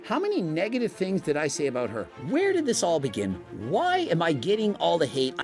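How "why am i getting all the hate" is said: usual speaking voice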